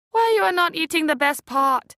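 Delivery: high, loud authoritative aunt; with authority. A narrator's reading. Auntie Lindo instructing Rich with authority, high and loud. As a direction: lay down the law about crab. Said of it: "said with much authority".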